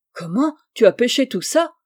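exclamation étonnée, plus forte; s'écrier, ébahi devant le panier; stupéfaction. Acted. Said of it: "s'écria-t-il stupéfait". (cri de surprise stupéfait)

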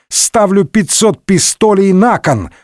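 high, slow, eager declaration; gleeful. A narrator's reading. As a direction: announce the stake with relish. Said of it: "радостно ответил номер второй". (радостно, с азартом)

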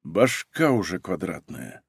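slow, low and soft, worn out; quiet, dragging weariness; drawn-out weary exhale. A narrator's reading. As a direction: let the words sag with tiredness. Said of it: "протяжно выдохнул Егор".

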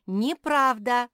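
slow, high, loud contradiction; shouted denial. A narrator's reading. Squirrel contradicting the bear cub, shouted denial. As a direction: shout it back, drawn out and rising. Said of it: "повторил Чинк".

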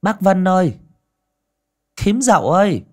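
loud call from outside. A narrator's reading. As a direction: call across the yard. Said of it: "có tiếng người gọi".

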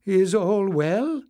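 high, slow rising query; questioning host. A narrator's reading. Knowles asks the panting messenger, slow and high. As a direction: ask carefully, voice lifted.

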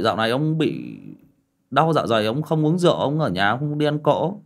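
ordinary talk, nothing marked

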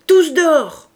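loud, high command to rush out; shouted order. A narrator's reading. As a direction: bark everyone out to the fight. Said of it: "cria Enjolras".

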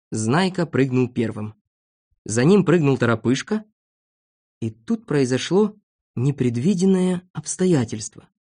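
ordinary delivery

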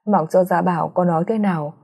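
usual speaking voice

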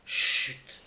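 whispered shush; hushing. A narrator's reading. Young lady silencing Gilles from upstairs, whispered hush. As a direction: hush them in a breath. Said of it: "une douce voix de femme".

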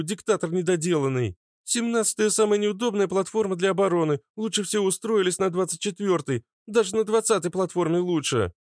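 high-pitched character voice, far above the narrator; high voice airing grievances; Фитилёк, talkative and scornful. A narrator's reading. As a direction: play Fitilyok in a high, lively voice.

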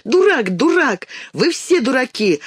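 put-on high-pitched girl's taunting cry; indignant child calling out, high; childish yelling. A narrator's reading. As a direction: yell it in a high voice. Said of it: "кричала".

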